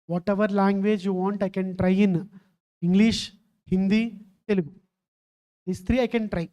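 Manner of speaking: ordinary talk, nothing marked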